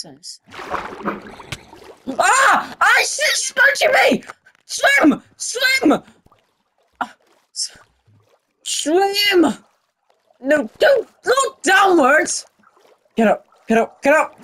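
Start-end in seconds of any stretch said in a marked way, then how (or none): 2.1-4.2 high panicked shout; panicked yell. Real, not acted. Attacked in the water, panicking. 4.7-9.5 high, urgent shouting; frantic shout. Real, not acted. Frantically urging the cow to swim. 10.4-12.5 high, slow, drawn-out shout; desperate shout. Real, not acted. Cow sinking, desperate shout.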